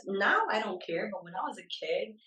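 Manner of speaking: ordinary delivery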